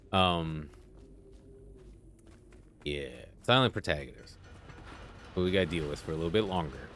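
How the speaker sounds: ordinary talk, nothing marked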